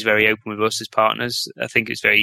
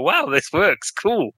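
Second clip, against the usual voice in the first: mock wowed, pitched up high; impressed reaction; 7 semitones higher, slower than usual. Imitating customers amazed that the software works, impressed. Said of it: "people were just impressed".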